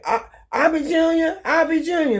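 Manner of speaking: high-pitched imitation of grandmother calling. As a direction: call the name out high. Acting out grandmother calling the father.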